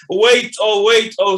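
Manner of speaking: loud pleading call; loud desperate plea; calling after him. Acted. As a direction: cry out for him to wait.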